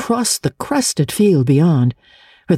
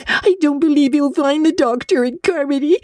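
much higher, tearful and broken; sobbing; 13 semitones higher than usual. Acted. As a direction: cry the words out in despair. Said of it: "sobbed Diana".

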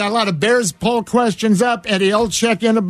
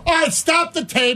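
higher, mock shout of an exasperated producer; half-joking higher shout of stop the tape; imagined producer; 8 semitones higher than usual. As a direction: yell it like a producer halting the tape. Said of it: "wouldn't you go".

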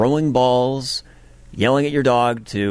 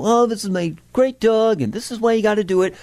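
higher, gushing mock dog owner, then rushed; mocking YouTuber; 9 semitones higher than usual. Anchor mocks a dog video intro in a higher gushing voice. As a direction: ham up a YouTuber cooing over her dog. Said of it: "a twenty-second intro about how".

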